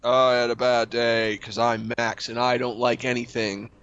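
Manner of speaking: low, slow, grumpy drone; mopey Max act. Pretend. Co-host plays a sulky version of himself for the host, low and slow. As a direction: play the guy who hates everything. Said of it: "you be me and I'll be you".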